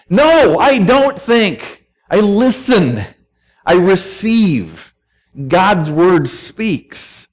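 high, slow, drawn-out refusal; emphatic rebuttal. Acted. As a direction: declare it firmly, one slow phrase at a time. Firm answer to the doubter's suggestion.

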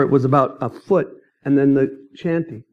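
ordinary delivery

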